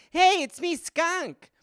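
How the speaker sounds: high, odd put-on voice; a silly character introducing herself; goofy girlfriend voice. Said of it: "your girlfriend".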